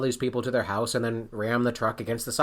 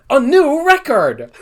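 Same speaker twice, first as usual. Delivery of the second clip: loud high announcer shout; game announcer; 12 semitones higher, 11 dB louder, slower than usual. Retold or imitated. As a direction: announce it like a game show host. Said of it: "from Whiskey's phone".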